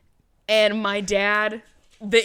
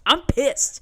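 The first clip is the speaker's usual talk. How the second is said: higher, louder indignant insistence; mock-pissed outburst; 6 semitones higher, 5 dB louder than usual. Traveler retelling her airport mood to co-host, mock pissed. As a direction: hammer it out in exaggerated annoyance. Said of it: "I'm like, I'm pissed".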